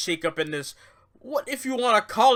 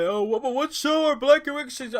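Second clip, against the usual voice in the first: high, flustered mimicry of puzzled people; mock confused fans; 7 semitones higher, faster than usual.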